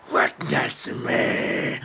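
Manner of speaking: slow whispered witness me; whispered battle cry. Pretend. Streamer echoes the Mad Max cry as a slow whisper. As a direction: breathe the war cry out, drawn long.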